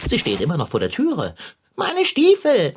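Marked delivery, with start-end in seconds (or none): 1.8-2.8 a sudden high, loud, drawn-out wail; alarmed cry. Acted. Cat remembering his boots outside, alarmed cry. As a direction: panic over the forgotten boots.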